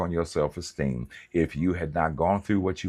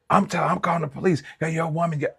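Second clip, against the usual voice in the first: high, fast, louder mock threat; mock ex yelling; 12 semitones higher, 3 dB louder, faster than usual. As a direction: squeal a threat to call the police. Ex yelling after being knocked down.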